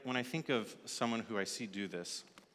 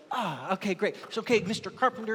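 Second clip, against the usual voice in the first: eager, higher and brighter, hurried; like a kid thrilled to help; excited; 8 semitones higher, 4 dB louder than usual. Acted. Newcomer thanking the carpenter, all excited. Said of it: "And he's all excited".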